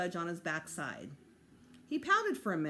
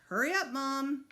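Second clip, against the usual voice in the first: louder, impatient call through the house; urgent call; 7 semitones higher, 5 dB louder than usual. A narrator's reading. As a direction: call out, pressing her to hurry. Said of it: "I called".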